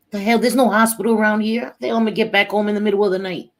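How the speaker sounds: loud, high, rapid complaint; imitating mad son. Son refusing to go to far-off hospital, imitated angry protest. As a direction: protest loudly in a rush, as the annoyed son. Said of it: "He was so mad at me".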